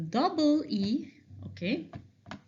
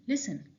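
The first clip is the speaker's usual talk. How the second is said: "listen" as LIS-en, said softly; unstressed last syllable; 4 dB quieter than usual. Shown as a demonstration. Teacher restoring correct word, "listen" with weak last syllable. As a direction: let the end of "listen" fall away unstressed. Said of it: "It's not stressed".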